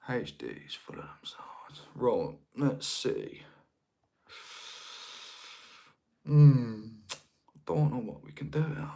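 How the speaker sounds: very soft, half-whispered self-talk; muttering to himself. Real, not acted. Streamer puzzling over controls to himself, muttering. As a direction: think aloud under your breath.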